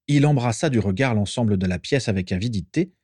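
ordinary delivery

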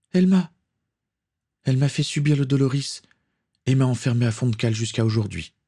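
put-on high child's voice, halting at the start; wronged little girl. A narrator's reading. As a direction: voice a girl telling what was done to her. Captive girl reports her mistreatment.

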